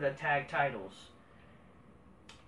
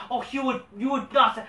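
usual speaking voice

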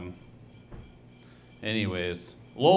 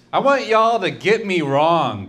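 higher, louder, belted at the crowd; mock crowd-pumping shout; 5 semitones higher, 7 dB louder than usual. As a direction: belt it like a big punchline. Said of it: "don't get me wrong".

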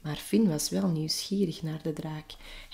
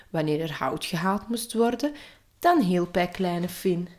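ordinary delivery, nothing marked

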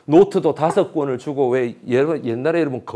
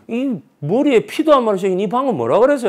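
raised, higher challenge; mock angry retort; 8 semitones higher, 3 dB louder than usual. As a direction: snap back at a rude elder. Retold quarrel with old man.

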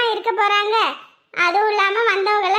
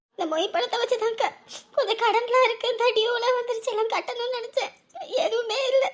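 high, weepy voice fading softer; acted plaintive sobbing; tearful lament; 4 semitones higher, 4 dB quieter than usual. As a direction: confide money worries while crying. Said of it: "அழாத, அழாத".

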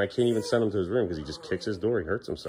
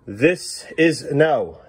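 slow drawn-out no; 3 semitones higher, 3 dB louder, much slower than usual. Shown as a demonstration. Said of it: "Please stop doing this".

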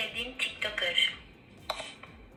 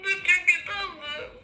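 tearful; 6 semitones higher, 4 dB louder than usual. Real, not acted. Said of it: "kız ağlıyor".

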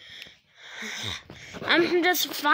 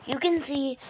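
normal talking voice

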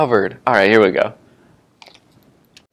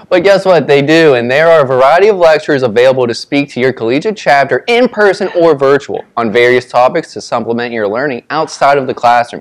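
loud ad read; 2 semitones higher, 4 dB louder than usual. Real, not acted. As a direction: push through the ad excitedly. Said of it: "read whatever we posted".

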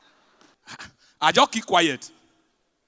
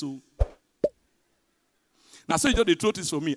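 ordinary talk

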